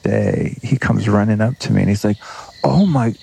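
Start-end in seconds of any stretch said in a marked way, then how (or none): 2.6-3.2 high-pitched, excited mock Aussie; hammed-up Aussie accent, pitched way up; butchered Aussie accent. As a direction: imitate a starstruck Australian fan, accent badly done. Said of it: "sorry, I'm butchering the Aussie accent".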